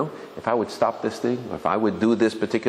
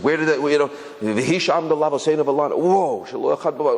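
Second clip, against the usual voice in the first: excited discovery; 6 semitones higher than usual. Retold or imitated. Sudden insight jumping out.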